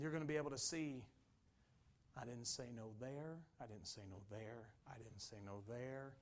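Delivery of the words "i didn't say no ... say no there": low, soft repeated realisation; quiet reflection. Acted. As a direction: recall each failure softly, as if to yourself. Acted looking back on missed refusals.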